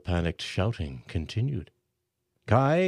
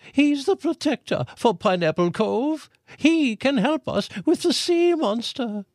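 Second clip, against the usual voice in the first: shouted; 4 dB louder than usual. A narrator's reading. Aunt Cora on stage shouting to the crowd. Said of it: "Aunt Cora shouted".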